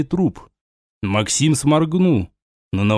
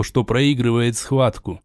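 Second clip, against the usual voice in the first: plain reading voice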